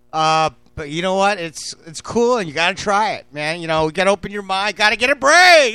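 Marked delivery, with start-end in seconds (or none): 4.8-5.7 higher, louder punchline call; loud catchphrase. Real, not acted. Host shouts his catchphrase at viewers, loud. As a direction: throw the line out like a slogan.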